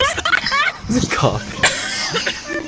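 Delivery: high, slow, laughing words. Real, not acted. Laughing at a cough-sneeze.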